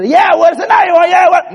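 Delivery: high, loud dare; taunting shout. Retold or imitated. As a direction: challenge him, shouting. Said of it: "brother taunting before nailing".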